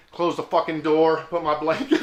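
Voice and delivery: ordinary delivery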